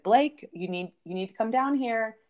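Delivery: lightly acted call to a kid; nanny calling a child. Nanny voiced calling child downstairs.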